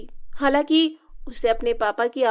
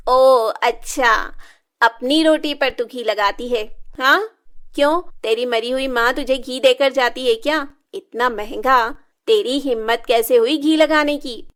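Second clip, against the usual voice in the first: sneering anger; 5 dB louder than usual. Acted. Stepmother taunting Shilpa about her dead mother, loud and cruel. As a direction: sneer then lash out loudly.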